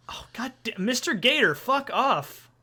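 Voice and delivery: annoyed outburst. Real, not acted. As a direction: shoo away a pest mid-story. Game creature interrupts the story.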